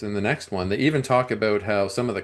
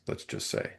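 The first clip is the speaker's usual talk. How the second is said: wry whisper; 11 dB quieter than usual. Real, not acted. Wry aside.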